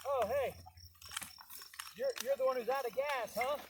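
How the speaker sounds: lightly voiced friendly clerk; cheery stranger.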